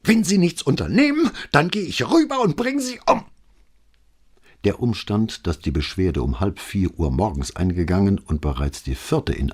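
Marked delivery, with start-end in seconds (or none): 0.0-3.2 very high, loud, overshooting threat; voice cracking with rage. A narrator's reading. Neighbour threatening her neighbour on the tape, cracking with rage. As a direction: let the voice break in fury. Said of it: "vor Wut fast überschnappende Stimme".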